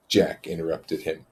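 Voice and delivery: plain reading voice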